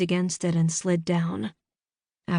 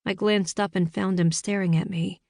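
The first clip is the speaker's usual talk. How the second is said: ordinary delivery, nothing marked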